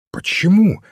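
quick high question, keenly curious; lively interest. A narrator's reading. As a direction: ask it with sudden eager interest. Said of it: "спросил О'Брайен с живым интересом".

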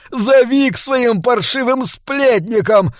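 high-pitched, slow, hysterical yelling; furious shriek. A narrator's reading. Maître d' rails at Humboldt's lousy gossiping friends, shrieking furiously. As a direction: screech each word out in drawn-out rage. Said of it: "яростно визжал метрдот".

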